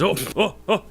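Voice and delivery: high, loud, short yelps of alarm; startled hill giant. Acted. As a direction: yelp as if patting out flames. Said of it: "He goes".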